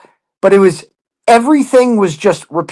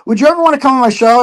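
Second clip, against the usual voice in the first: mock eager invite; 7 semitones higher, faster than usual. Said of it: "she asked me, like".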